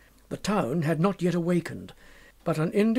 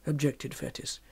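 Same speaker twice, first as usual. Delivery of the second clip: usual speaking voice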